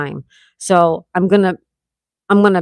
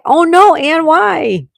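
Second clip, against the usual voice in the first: mock dismay; 9 semitones higher, 5 dB louder, much slower than usual. Mock alarm at "sit and cry.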